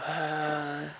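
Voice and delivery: soft, long exhaling sigh; big sigh. Shown as a demonstration. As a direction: let the breath fall out in a sigh. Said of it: "just do a big sigh and go".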